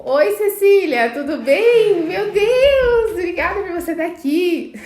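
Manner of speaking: light up and gush a welcome to a friend; bubbly real delight at seeing Cecília; excited greeting. Real, not acted. Greets a viewer live, delighted.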